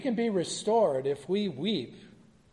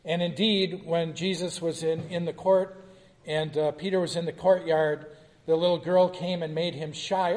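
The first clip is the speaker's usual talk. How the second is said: usual speaking voice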